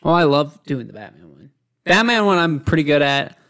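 ordinary talk

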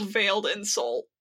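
usual speaking voice

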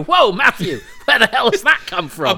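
high, loud mock shock; a startled, laughing whoa at the boy; shocked reply. Listener reacting to the boy's dark line with loud shock. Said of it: "And you go".

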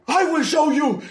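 high, loud mock bellow; mock threat. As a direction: bark the threat like a cartoon brute. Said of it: "Y dice".

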